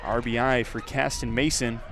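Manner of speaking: ordinary delivery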